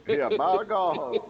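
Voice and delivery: slow, high and loud "by God"; drawn-out mock commentator cry; mock announcer catchphrase. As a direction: bellow it like a wrestling announcer. Echoing a wrestling catchphrase.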